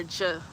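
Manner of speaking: J said as a soft "juh"; short soft "juh" for J; soft G sound. Shown as a demonstration. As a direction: say the letter J as its sound, as in "jump". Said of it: "like a soft G or a hard G".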